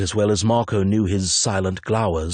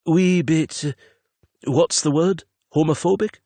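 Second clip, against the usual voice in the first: sheepish Marco; 9 semitones higher than usual. Acted. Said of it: "He smiled shakily".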